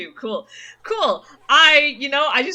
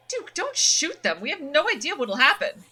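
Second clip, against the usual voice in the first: worried Stella; 6 semitones higher, 3 dB louder than usual. Acted. Voices Stella in game.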